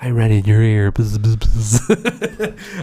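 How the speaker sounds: mock ASMR voice, close and intimate; right in your ear. Shown as a demonstration. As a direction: tease it like a parody ASMR video. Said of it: "I talk very softly like this".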